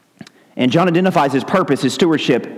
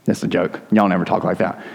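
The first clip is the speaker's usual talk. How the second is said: usual speaking voice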